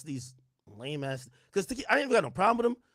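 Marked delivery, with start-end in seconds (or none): 1.5-2.8 worked up. Real, not acted. Venting about rappers dissing him.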